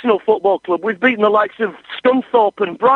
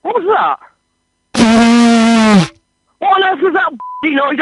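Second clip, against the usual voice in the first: startled; 4 semitones higher, 4 dB louder, slower than usual. Real, not acted. Vuvuzela blown in ear.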